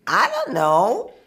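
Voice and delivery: high, loud, drawn-out denial; loud, slow protest of not knowing; emphatic cluelessness. Real, not acted. Insists she has no idea.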